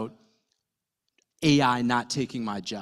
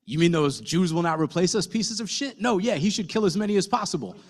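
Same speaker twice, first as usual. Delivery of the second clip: a higher, louder, ranting Larry David bit; a lightly voiced Larry riffing, pitched up; Larry David impression; 8 semitones higher, 4 dB louder than usual. Larry David ranting to Len and Miri on the phone, higher. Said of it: "and Larry's like".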